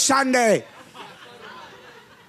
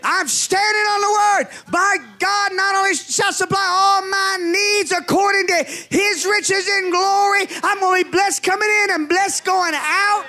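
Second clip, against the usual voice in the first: full-force preaching; 3 semitones higher than usual. Acted. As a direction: preach it defiantly.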